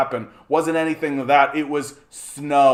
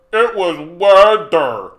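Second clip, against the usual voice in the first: sarcastic mock; 4 semitones higher, 9 dB louder, slower than usual. Mocking chat's claim, sarcastic.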